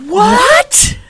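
high, loud disbelief; shocked what. Real, not acted. Hearing the guest never drank coffee, shocked and loud. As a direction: gasp out a stunned what.

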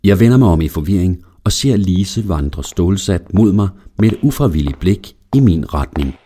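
usual speaking voice

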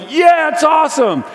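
high, loud yell; crowd shouting back. Retold or imitated. Crowd yelling back to the walker, high and loud.

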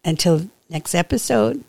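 ordinary delivery

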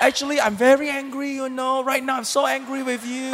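badly faked, sweetly announced anger; hammed-up, harmless quarrel voice; mock angelic anger. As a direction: play at being angry far too politely. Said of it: "angelic in their argue".

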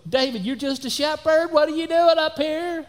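high, mocking big-brother voice; older brother sneering at David, high and loud; belittling older brother. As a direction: talk down to the little shepherd boy, pitched high.

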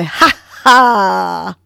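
punchy loud ha ha with hands out. Shown as a demonstration. Host leading listeners in laughter, loud ha ha. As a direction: throw out a loud ha ha. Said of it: "and say".

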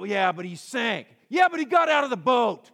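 objection building to a high, loud retort; heated rebuttal. Acted. Preacher defends Peter against his doubters, loud and emphatic. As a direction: argue back, rising to a near shout.

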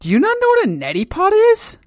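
normal talking voice

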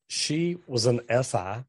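ordinary talk

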